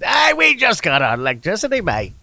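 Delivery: mock Cockney accent. Podcast guest imagines the kid's London voice, mock Cockney accent. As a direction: tease with a put-on Cockney lilt. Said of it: "like, a Cockney accent".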